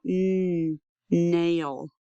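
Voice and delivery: long n, then nail; sounded-out onset. Shown as a demonstration. Teacher isolates the first sound, long n before nail. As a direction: hum the n alone, pause, then say nail. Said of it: "Do you hear n".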